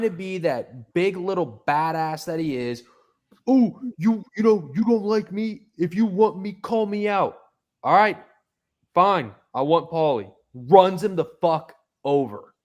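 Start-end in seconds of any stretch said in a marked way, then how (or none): 3.5-10.3 higher, whiny mock tough guy; mocking Paulie. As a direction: imitate a small tough guy calling out. Mocking Paulie calling someone out.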